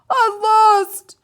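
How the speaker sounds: high, very loud joyful shout. A narrator's reading. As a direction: shout it out in triumph. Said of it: "shouted Toad".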